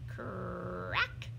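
plain reading voice

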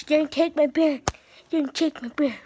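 loud, real protest over the bear; protesting shout. Real, not acted. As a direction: yell at her to give it back. Kid protesting toy taken.

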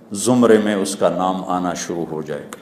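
usual speaking voice

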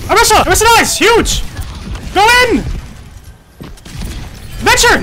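excited callouts. Real, not acted. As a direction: cheer the team on mid-fight. Hype callouts in fight.

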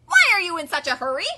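loud, high, quick duck voice; quacking duck. A narrator's reading. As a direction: quack the question out fast. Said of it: "he quacked".

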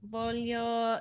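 "voglio" drawn out very slowly in Italian; Italian pronunciation. Shown as a demonstration. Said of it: "you could say".

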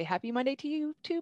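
high, lilting chirpy return greeting; cheerful reply. Retold or imitated. People always responding to her greeting, cheerful. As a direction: imitate a cheery reply, pitch climbing.

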